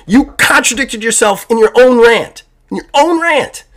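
high, loud exasperated repeats of "own"; co-host losing it at his friend's game review, high and loud; mad, mocking rant. Real, not acted. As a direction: hammer "In your own rant" again and again, voice climbing. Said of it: "I am actually mad right now".

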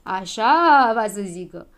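loud, slow, offended retort; offended storybook outburst; snapped back. A narrator's reading. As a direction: draw it out in loud indignation. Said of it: "se răsti Habar N-am".

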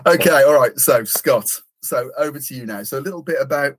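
usual speaking voice

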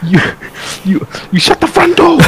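stammered start into a high yell; hammed-up high-pitched telling-off; mock self-censored outrage. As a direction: sputter a clean substitute for swearing, high. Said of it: "trying not to, you know, swear at her".

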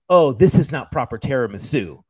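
loud mock indignation; nitpicking commenter. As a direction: scold like a pedantic online critic. Mimicking nitpicking online commenters.

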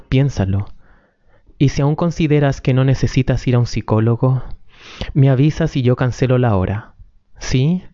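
usual speaking voice

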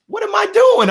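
high, loud exasperated question; mock frustration. Retelling a bad season, loud and exasperated. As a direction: throw up your hands, bewildered. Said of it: "it's like".

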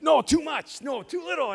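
loud high haggling cries; hammed-up bargaining yell; mock haggling shout. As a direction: bark back prices like a market haggler. Retold market haggling argument.

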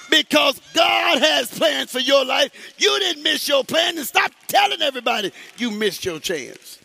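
loud, high shouted sermon; full-force preaching. Acted. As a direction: drive it at the crowd, building.

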